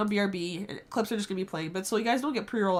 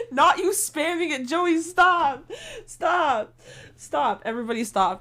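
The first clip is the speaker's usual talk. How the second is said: loud scolding; 5 semitones higher, 7 dB louder than usual. Real, not acted. Scolding chat loudly.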